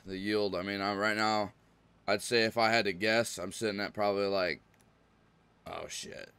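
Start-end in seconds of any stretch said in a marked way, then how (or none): 5.7-6.3 muttered under breath. Real, not acted. Muttered reaction mid-sentence.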